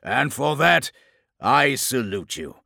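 slow, grand speech building to loud, high proclamation; monstrous warlord, serious. A narrator's reading. Chaos lord judging the captain, serious, building. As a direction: start solemn, swell into booming zeal. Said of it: "his tone growing serious".

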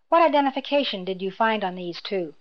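usual speaking voice

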